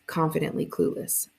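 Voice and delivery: ordinary delivery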